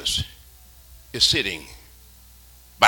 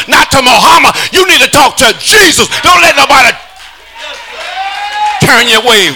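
fiery preaching; 6 semitones higher than usual. Acted. Preacher crying out need to the church, shouting. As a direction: build to a shout for the crowd.

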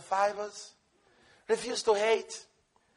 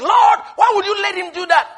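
loud, high indignant plea; whiny complaint to God; 7 semitones higher, 7 dB louder than usual. Retold or imitated. Believer complaining to God after the chase, loud and high. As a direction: protest to the Lord like you were wronged. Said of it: "and you're like".

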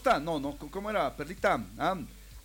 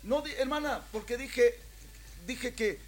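protesta indignada; 5 semitones higher, 4 dB louder than usual. Retold or imitated. Protesta indignada por el despido.

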